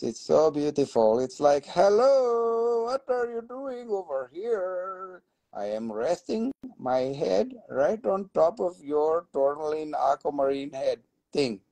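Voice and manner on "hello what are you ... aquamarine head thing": talking mineral face. Pretend. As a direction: voice the stone as a playful little creature. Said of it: "it's looking at you like a face".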